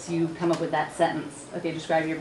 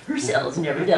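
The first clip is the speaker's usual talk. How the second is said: mock film-trailer announcer; movie trailer voice; 4 dB louder than usual. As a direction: lightly put on a dramatic trailer voiceover. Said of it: "like the movies, where there's a guy".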